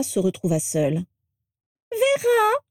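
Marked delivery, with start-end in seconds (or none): 1.9-2.6 put-on very high, screechy old-portrait cry; rattle-voiced portrait. A narrator's reading. Portrait calls Vera from the library corner, screechy exclamation. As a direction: call out in a piercing rattle of a voice. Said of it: "s'exclama aussitôt une voix de crécelle".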